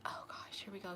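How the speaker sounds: hushed whisper, dreading her. Retold or imitated. Ex whispering as his girlfriend calls his name. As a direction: whisper it so she won't hear.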